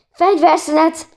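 high, loud call for a truce; panted cry. A narrator's reading. Zizi calls off the water fight, panted cry. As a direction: call out as if winded. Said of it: "lihegett egy idő után Zizi".

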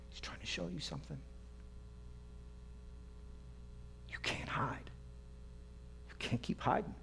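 near-whispered, very soft pleading; hushed appeal. Real, not acted. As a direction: drop to a hush and urge them gently.

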